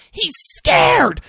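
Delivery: very high, loud hammed-up argument; escalated angry yell. She argues back over lying to scared people, yelling. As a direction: yell back at the top of your range. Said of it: "it elevates it immediately".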